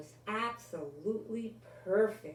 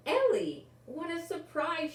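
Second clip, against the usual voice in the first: surprised; 9 semitones higher, 5 dB louder than usual. A narrator's reading. Maggie greets Ellie surprised.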